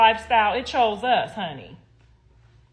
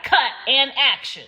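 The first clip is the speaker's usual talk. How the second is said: louder, mocking the cue; mock director cue; 5 semitones higher, 5 dB louder than usual. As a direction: spit out the cue to mock her. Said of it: "It was like".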